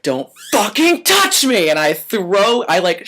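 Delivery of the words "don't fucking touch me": slow, higher, furious yell; retold yell. Waiter yelling at the boss who grabbed him, slow and furious. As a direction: spit each word out at him.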